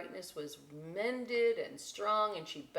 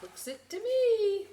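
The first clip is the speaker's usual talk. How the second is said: silly voice; 9 dB louder than usual. Acted. Joking about the octopus.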